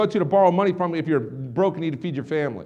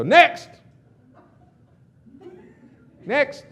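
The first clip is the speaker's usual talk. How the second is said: high-pitched repeated call; yell; 10 semitones higher, 5 dB louder than usual. Retold or imitated. Rep sits down and summons the next person, yelling. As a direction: re-enact hollering for whoever is waiting. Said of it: "and I yell".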